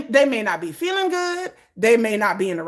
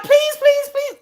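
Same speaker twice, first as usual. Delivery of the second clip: high, louder whiny begging; mock begging fan; 5 dB louder, slower than usual. Imitating the fan begging the actor, high whiny. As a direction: beg in a high put-on whine. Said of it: "And she's like".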